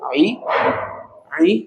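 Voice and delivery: throaty ain sound on आई; from the throat. Shown as a demonstration. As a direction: bring आई out from the throat. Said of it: "ये आइन यहाँ से निकलेगा हलक से".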